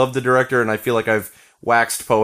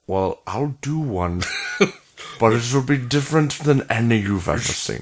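Hitchcock; 4 semitones lower than usual. Acted. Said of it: "My Hitchcock is not developed".

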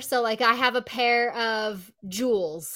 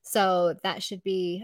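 ordinary talk

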